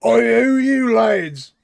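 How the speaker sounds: loud, high, slow cockney guard shout; rough guard. Acted. Guard at bottom shouting at intruders, loud cockney. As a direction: bark it out as a rough British guard. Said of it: "and they go".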